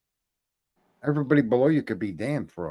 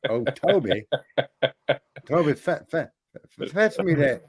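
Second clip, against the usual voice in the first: imitating an old Southern master calling, higher and louder; hammed-up Southern drawl, calling a servant; mock Southern plantation voice; 5 semitones higher, 4 dB louder than usual. Mocking Kamala's fake Southern accent, hammed-up Southern call. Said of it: "what she was faking that accent to be like".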